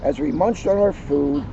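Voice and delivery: put-on cheerful children's book narration; story reading. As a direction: read it like story time for kids. Reads picture book aloud to audience.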